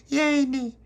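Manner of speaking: put-on very high, loud baby squeal; delighted baby voice. A narrator's reading. Baby Lucia getting her jam butty, squealing in delight.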